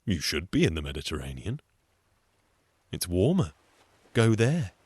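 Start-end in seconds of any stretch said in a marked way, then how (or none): none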